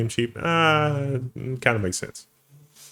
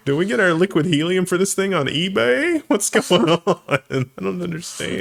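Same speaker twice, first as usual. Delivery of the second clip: baffled technician; 7 semitones higher than usual. Acted. Plays baffled MRI technician.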